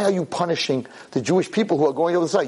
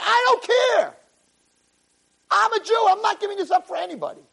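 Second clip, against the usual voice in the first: defiant. Pretend. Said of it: "you're like".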